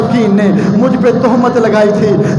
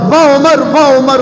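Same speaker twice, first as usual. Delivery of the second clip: normal talking voice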